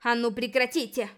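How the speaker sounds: loud stern order; commanding. A narrator's reading. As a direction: order him to stop. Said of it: "велела она".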